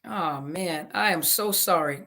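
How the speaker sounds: ordinary talk, nothing marked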